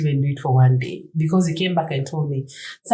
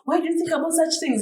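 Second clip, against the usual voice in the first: high, light mock of a carefree husband; mock husband, dismissive; 11 semitones higher than usual. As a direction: brush it off like the unbothered husband. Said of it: "And he's like".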